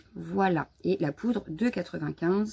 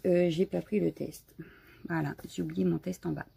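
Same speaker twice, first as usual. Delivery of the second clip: ordinary talk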